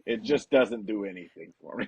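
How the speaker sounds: a calm deliberate father dismissing it; imitating Lars's dad. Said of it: "and he goes".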